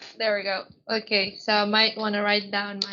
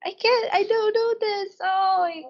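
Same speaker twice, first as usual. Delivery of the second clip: confused whine; 8 semitones higher than usual. Retold or imitated. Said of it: "And I was just like".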